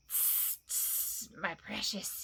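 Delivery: hushed hisses and murmur; soft hissing. Acted. Gollum mulls Bilbo's riddle, soft hissing. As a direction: whisper to yourself. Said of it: "he said".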